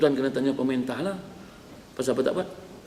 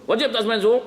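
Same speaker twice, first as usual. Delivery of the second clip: higher pitched mock-innocent asking; pressing question, pitched up; questioner; 7 semitones higher, 5 dB louder, faster than usual. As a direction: play the persistent asker. Acted question-and-answer on prayer time.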